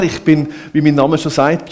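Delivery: ordinary delivery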